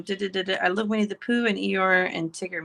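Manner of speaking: ordinary talk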